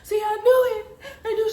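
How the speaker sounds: high, breathless fretting; high-pitched upset exclaiming; alarmed mother. Retold or imitated. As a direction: imitate mom working herself up. Voices mom's alarmed reaction.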